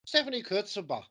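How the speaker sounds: an Italian name in a hammed-up Irish accent. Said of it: "say this in a way which I think is correct in the, uh, accent".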